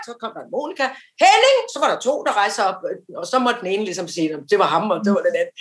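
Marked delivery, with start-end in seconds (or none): 1.2-1.6 much higher, loud call; calling out a name. Retold or imitated. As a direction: sing out the name across the room. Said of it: "med høj, klar stemme, øh, og råbe ud". (høj, klar råben af navnet)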